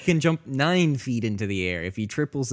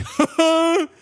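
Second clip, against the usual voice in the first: put-on high, drawn-out children's program host; mock kids' show; 8 semitones higher, slower than usual. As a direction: put on a silly, sing-out TV host voice.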